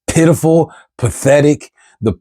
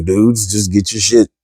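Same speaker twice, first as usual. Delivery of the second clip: usual speaking voice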